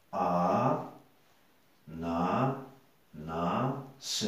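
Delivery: "a... na... na... se" with long gaps; each syllable of "a, na, na, se" held, then a pause. Shown as a demonstration. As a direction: slowly space out "a, na, na, se" for counting. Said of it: "quatre syllabes".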